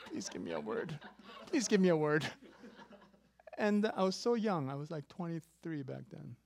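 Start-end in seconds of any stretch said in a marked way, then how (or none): 0.1-1.0 low, softer mock begging; silent plea voiced. Eyes begging the prophet for a word, low plea. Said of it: "but I think my eyes". 1.5-2.3 mock begging, voice lifted and louder; louder repeated plea. Eyes begging the prophet for a word, louder plea. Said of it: "but I think my eyes".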